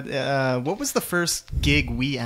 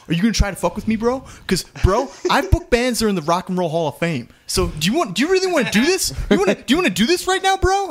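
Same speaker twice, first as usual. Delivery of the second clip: climbing higher and faster, pushy; aggressive bro voice; 8 semitones higher, 4 dB louder, faster than usual. Retold or imitated. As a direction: rile up like a hostile promoter. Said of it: "And he's just like".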